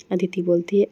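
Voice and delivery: ordinary delivery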